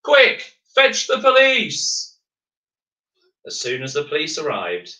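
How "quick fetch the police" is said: urgent shout. Acted. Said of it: "they all shouted".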